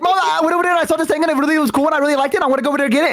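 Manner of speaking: put-on excited kid. A kid rushing to tell mom everything, fast and excited. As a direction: gush it all out in one breath like an eager kid. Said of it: "'cause I'd be excited about something and I'd be like".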